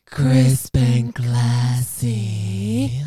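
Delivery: slow, sassy band-name reveal; sassy name drop. Pretend. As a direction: stretch each word out with sass. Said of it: "the oh so sassy name of".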